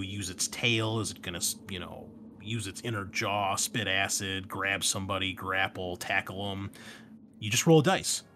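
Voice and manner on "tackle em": normal talking voice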